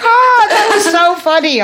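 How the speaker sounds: high, gushing mock voice; imitating amused girl. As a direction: mimic her tickled reaction up high. Imitates the girl's amused reply.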